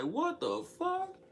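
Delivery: high slow outraged question; stunned disbelief. Retold or imitated. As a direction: stretch it out in shocked disbelief. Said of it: "I was like".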